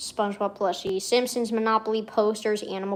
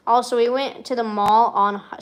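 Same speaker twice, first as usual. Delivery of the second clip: normal talking voice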